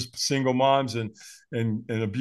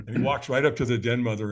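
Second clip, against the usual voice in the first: ordinary talk, nothing marked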